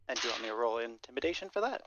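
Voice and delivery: ordinary talk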